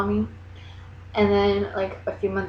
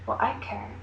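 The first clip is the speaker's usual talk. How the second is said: muttered retort; 3 semitones higher, 5 dB quieter than usual. Retold or imitated. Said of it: "I'm just like".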